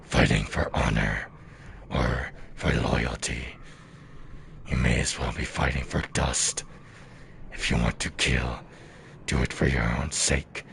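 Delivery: deep, grave warrior speech; Dung Defender voice. Acted. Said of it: "very accurate voice-wise now".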